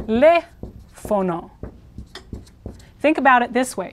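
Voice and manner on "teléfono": teléfono very slowly in separated syllables, stressed on LE. Shown as a demonstration. Teacher breaking down the word for telephone, slow te-LE-fono. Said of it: "It's here".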